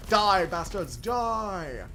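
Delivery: villain taunt. Pretend. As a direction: play a gloating supervillain.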